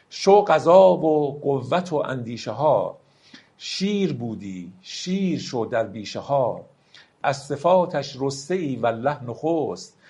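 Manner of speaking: slow, solemn verse reading; slow poem recital. Acted. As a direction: let each line of the poem ring out slowly. Recites Rumi verse slowly. (دکلمهٔ آرام و باوقار شعر مولانا)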